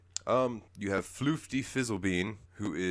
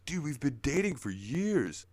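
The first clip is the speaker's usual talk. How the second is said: higher put-on voice, amused; lightly voiced laid-back buddy; Chandlo's voice; 7 semitones higher than usual. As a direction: tell him like it's obvious. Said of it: "Chandler's like".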